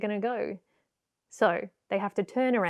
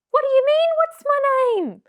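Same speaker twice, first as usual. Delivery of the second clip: very high, indignant puppet voice; squeaky puppet taken aback by the question; Lisa; 3 dB louder than usual. Pretend. Puppet answering its puppeteer, affronted squeaky voice. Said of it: "My name's Lisa".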